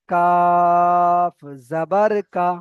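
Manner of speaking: long loud "কাফ", short unstretched final "কা"; no drawl at end. Shown as a demonstration. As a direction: hold "কাফ", then cut "কা" short. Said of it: "কোনো টান দেওয়া যাবে না শেষে".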